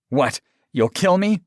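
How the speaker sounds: high, loud, defiant retort; angrily snapped. A narrator's reading. Aaron challenging his lover's threat, angry. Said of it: "Aaron angrily snapped".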